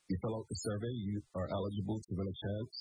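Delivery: ordinary talk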